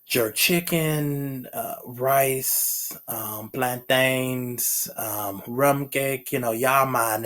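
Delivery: slow, drawn-out bad Jamaican accent; a clumsy try at a Jamaican accent. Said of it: "I can't do a Jamaican accent".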